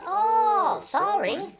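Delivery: fake-sorry, dripping with sarcasm; hammed-up sarcastic reply. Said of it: "And Rob Gauthier goes".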